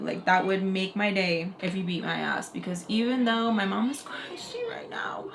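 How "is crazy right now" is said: hushed, breath-only aside; whispered aside. Real, not acted. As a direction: whisper it like a confidence. Hushed aside about her mother.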